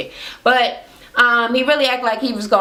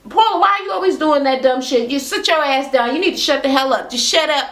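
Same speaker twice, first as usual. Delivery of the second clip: higher, quick telling-off; retold snippy reprimand, pitched up; irritated scolding; 4 semitones higher, faster than usual. Lisa shuts Paula down for interrupting, snippy and higher.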